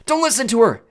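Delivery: high, loud urgent shout; shouted warning. A narrator's reading. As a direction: shout a desperate warning across a distance. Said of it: "Annabeth's voice shouted".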